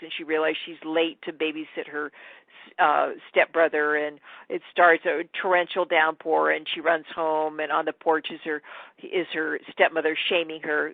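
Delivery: normal talking voice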